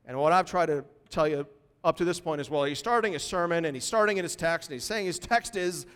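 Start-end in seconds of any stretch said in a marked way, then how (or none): none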